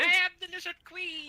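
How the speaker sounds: Lizard Queen quote. Acted. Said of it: "I was gonna say that! Stole my quote".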